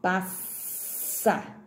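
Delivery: "passa" broken by a long hissed SS; strong S. Shown as a demonstration. Teacher showing the double S, "passa" with a long strong hiss. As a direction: hold the hiss in the middle of "passa". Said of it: "som forte, dois S's".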